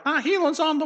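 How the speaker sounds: high, mocking "healing's on the way"; mocking faith healer. Preacher mocks Christians claiming healing's on the way, high mock voice. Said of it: "I realize I just made fun of it".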